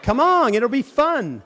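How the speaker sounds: high, coaxing come-on; coaxing sibling. Retold or imitated. Sibling coaxing a wary brother, high and wheedling. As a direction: lure them in with a bright tease.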